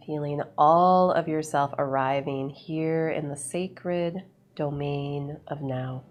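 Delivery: ordinary talk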